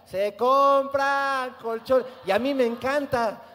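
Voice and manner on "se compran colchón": slow drawn-out street vendor loudspeaker call; crackly looped vendor recording. Retold or imitated. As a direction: stretch it like the colchón truck recording. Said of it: "se oía un ruido que decía". (grabación de vendedor ambulante en bucle)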